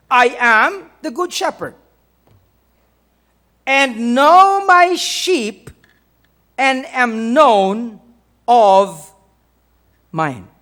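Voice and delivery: very slow, loud proclamation, easing at the end; scripture reading. Acted. Preacher quoting the good shepherd verse, drawn-out emphatic reading. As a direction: draw out each phrase with weight. Reads scripture aloud slowly.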